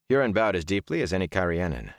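ordinary delivery

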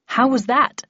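pleased with yourself, pitch lifted a little; proud. A narrator's reading. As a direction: ask it quite proudly. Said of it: "I asked quite proudly".

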